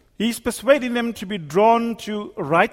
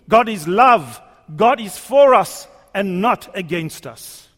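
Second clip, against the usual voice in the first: loud, ringing proclamation, each phrase landing hard; full-force preaching; 3 semitones higher, 5 dB louder, slower than usual. Acted. Preacher proclaiming God's nature to the church, loud and stirring. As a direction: preach it out to the back pew.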